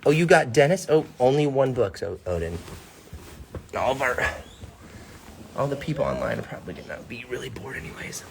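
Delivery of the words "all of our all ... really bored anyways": fast whispered aside. Real, not acted. Aside to child.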